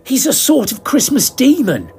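high, lightly voiced sidekick; a hint of a nervous little man; explaining. A narrator's reading. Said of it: "Grendel explained".

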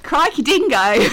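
put-on playful Australian accent on request. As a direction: blurt it like a cartoon Aussie. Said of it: "do me Australian one more time".

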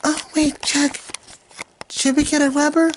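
high girly mock voice; high-pitched mock Tiffany.

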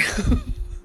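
nervous, high chuckle; uncomfortable laugh. Real, not acted. Host admitting the world got worse, uncomfortable laugh. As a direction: laugh it off uneasily. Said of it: "I'm laughing because it makes me uncomfortable".

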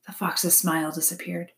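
plain reading voice

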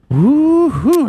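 high, loud woo-hoo of triumph; cheering. Real, not acted. Gamer whooping after nailing a tricky name, cheering. Celebrating getting a name right.

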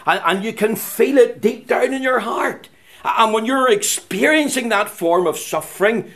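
fervent preacher's challenge; impassioned preaching. Acted. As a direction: press each question on the congregation, pitched high. Preaches at raised pitch.

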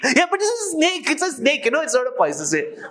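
high, fast, frantic wife voice; panicky wife. Retold or imitated. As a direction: gabble it like a frightened wife. Wife panics about the snake.

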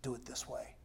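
soft seductive suggestion; tempting whisper. Acted. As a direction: lure them in under your breath. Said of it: "the world is gonna whisper to us".

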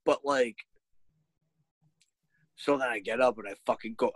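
ordinary talk

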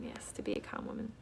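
berbisik pelan; whisper. Real, not acted. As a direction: bisikkan seperti rahasia. Reading a comment, whispered.

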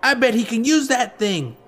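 put-on high, wary kid voice; cautioning. A narrator's reading. Gang kid to others about the sword, cautioning. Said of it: "one of the others cautioned".

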